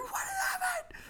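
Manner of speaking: tiny high-pitched mock sob; imitated crying child. Brother rescued from the gap, imitated crying. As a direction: squeak it through pretend tears. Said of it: "He's, like, crying".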